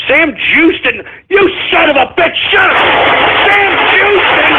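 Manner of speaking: high-pitched furious tirade; outraged screaming. Real, not acted. Host outraged by Sam Houston prank name, furious screaming. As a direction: build from indignant cry to a high scream.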